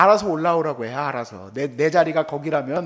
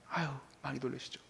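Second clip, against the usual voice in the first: much softer, quick consoling murmur; soft fast sympathy; 6 semitones lower, 19 dB quieter, faster than usual. Retold or imitated. Pastor soothes the other driver, much softer and quick. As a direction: hush it kindly and quickly.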